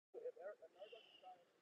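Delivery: distant off-mic voice; drifting away from the phone; phone held away. Real, not acted. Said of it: "Come back closer".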